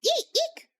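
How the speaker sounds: tiny high squeaks; little mouse-like eek. Real, not acted. Playful tiny squeaks after laughing.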